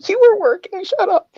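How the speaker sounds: squeaky, very high-pitched protest; indignant retort. Real, not acted. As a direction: fire back in a high squeal. Mock squabble with friend on stream.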